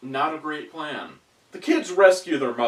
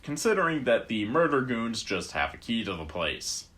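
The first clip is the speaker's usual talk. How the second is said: usual speaking voice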